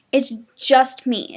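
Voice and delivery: ordinary talk, nothing marked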